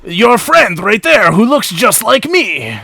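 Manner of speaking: drawn-out fake French indignation; bad French accent. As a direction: accuse in a clumsy French accent. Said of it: "do a really bad French accent".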